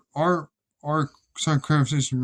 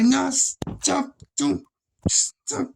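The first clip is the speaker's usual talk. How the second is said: cringing plea; 10 semitones higher, much slower than usual. Retold or imitated. Begging coworker to stop singing.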